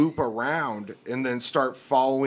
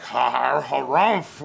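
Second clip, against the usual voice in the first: pompous harrumph; 4 dB louder, slower than usual. Retold or imitated. Manager bursting through the doors, mock pompous harrumph. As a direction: play the manager bursting in to make a show, all bluster. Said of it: "making a big display".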